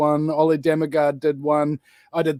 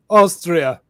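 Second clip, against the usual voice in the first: lightly acted answer, a little louder; film impression; 6 dB louder than usual. Answering where the accent is from, lightly acted impression. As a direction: give the film line with a falling tune. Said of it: "on Dumb and Dumber".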